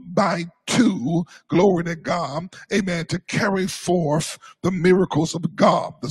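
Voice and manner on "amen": usual speaking voice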